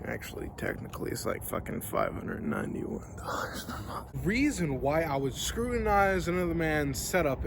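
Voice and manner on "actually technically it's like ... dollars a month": hushed unvoiced aside under the breath; whispered aside. Real, not acted. Confessing the true rent to viewers, whispered. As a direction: whisper the real price like a secret.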